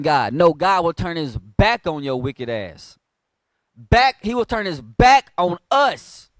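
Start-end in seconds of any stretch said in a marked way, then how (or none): 3.8-6.3 forceful preaching. Acted.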